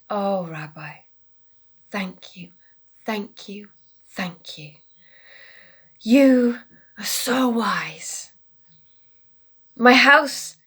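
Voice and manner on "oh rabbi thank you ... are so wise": soft, breathy thank-yous; grateful whisper. Acted. Rested man thanking his rabbi, soft whisper.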